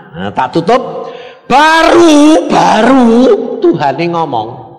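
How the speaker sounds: ordinary talk, nothing marked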